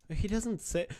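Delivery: high, loud disbelieving snap back; loud scoffing disbelief at the clip; incredulous retort. Real, not acted. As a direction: call out the missing line, stunned. Streamer rebutting clip in disbelief.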